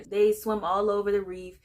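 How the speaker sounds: usual speaking voice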